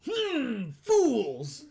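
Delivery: high, booming cartoon villain. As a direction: roar it like an evil overlord. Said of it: "Why does he sound like a villain?".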